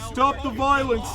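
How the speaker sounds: pleading call. Acted. Chanting to stop brawl.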